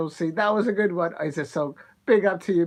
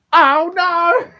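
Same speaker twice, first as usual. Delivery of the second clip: much higher, louder mock wail; mock despair; 12 semitones higher, 12 dB louder than usual. Real, not acted. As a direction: cry out in comic despair. Troller returns to chat.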